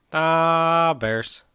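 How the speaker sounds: exaggerated Chicago accent. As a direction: stretch DAW way out like a hammed-up Chicago fan. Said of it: "I could do way better".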